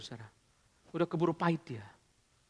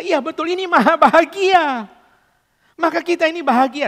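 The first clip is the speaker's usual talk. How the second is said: fervent preaching; 9 semitones higher, 12 dB louder than usual. Acted. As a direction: proclaim with awe. Preacher climaxes on God's happiness.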